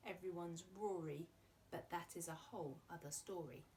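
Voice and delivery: rhythmic read-aloud with a bouncing beat; poem reading. Acted. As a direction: read it to children, leaning on the rhythm. Reads a rhyming poem aloud.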